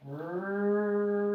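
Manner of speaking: R held as one long, steady rrr; sounded-out R. Shown as a demonstration. Teacher sounding out the start of rattle for a pupil, drawn-out R. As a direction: stretch the R out loud.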